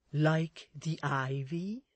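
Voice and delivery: slow, rising, questioning character voice; tentative suggestion. Acted. Miss Tox supplies the missing simile, tentative suggestion. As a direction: offer it slowly as a prompt. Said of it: "suggested Miss Tox".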